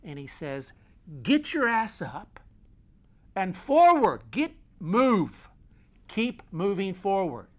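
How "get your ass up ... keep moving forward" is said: loud, high drill-sergeant orders; barked military command, easing at the end; master sergeant. Acted. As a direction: shout them back onto their feet. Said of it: "Just like a master sergeant".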